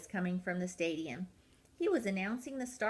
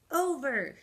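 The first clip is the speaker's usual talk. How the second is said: a raised, higher over; coach's shout; 5 semitones higher, 6 dB louder than usual. Acted. Coach Peg shouts to the penguins on the course. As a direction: call the jump out like a coach. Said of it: "shouted Peg".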